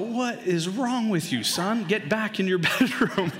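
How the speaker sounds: high-pitched mock outrage; exasperated dad scolding. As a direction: order the kid out in comic exasperation.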